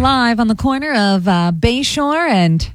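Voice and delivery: formal live-on-scene reporter voice; cliche news reporter. Shown as a demonstration. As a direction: play up the local TV field reporter. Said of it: "cliche news report".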